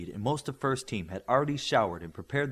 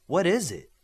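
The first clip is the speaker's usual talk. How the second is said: higher, louder eager question; excited; 7 semitones higher, 6 dB louder than usual. Acted. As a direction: ask it bursting with curiosity. Eager question about boxes.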